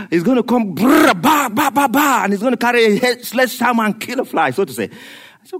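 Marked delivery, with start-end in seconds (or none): 0.7-2.2 mock rumbling destruction noise. Imitating God's destruction.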